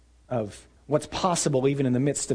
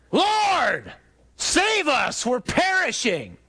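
high, drawn-out yell over the storm; panicked yell; 12 semitones higher, 3 dB louder, much slower than usual. Acted. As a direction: shout for help to the back of the boat. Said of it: "one of us yells to the back of the boat".

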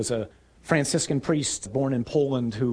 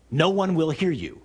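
ordinary talk

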